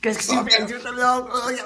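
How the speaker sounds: high, slow mock-French question; French insult. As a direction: squeak it out like a silly Frenchman. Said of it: "throws insults at him in French".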